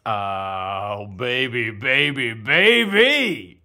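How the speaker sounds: over-the-top playful wooing; love-potion swoon. Pretend. Acting transformed by love potion.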